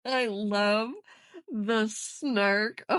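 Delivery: words bubbling with laughter; laughing delight. Real, not acted. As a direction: giggle through it. Laughing while opening gift.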